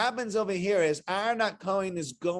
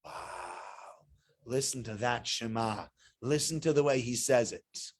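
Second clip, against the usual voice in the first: much softer, lower hushed admiration; mock awe; 8 semitones lower, 6 dB quieter, slower than usual. Listeners marvel at his Shema, hushed. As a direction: sigh it out as an impressed onlooker. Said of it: "everyone's gonna be so impressed".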